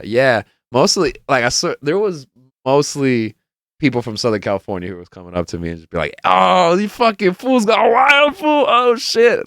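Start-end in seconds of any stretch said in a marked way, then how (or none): 6.2-9.5 high, drawn-out hyped crowd voice; imitated excited fans. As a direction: gush it like an amazed fan. Imitating excited festival fans approaching.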